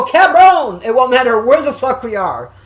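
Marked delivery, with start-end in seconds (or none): 0.0-0.8 high, loud, drawn-out call; hollered greeting. Retold or imitated. Woman re-enacting how she greets her friend, loud holler. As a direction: holler back to an old buddy. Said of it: "And I go".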